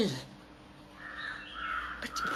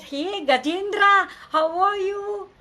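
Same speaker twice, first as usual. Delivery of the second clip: put-on friendly boy; 7 semitones higher than usual. A narrator's reading. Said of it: "he said".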